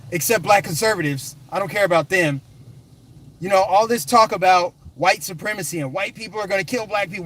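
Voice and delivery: ordinary talk, nothing marked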